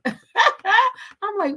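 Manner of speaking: laughing. Real, not acted. Laughing before retold thought.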